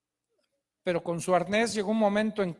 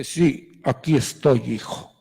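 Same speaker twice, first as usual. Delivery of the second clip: much lower, loud, booming divine voice; voice of God; 10 semitones lower, 5 dB louder than usual. Acted. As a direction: speak as God from above, deep and resonant. God answers again.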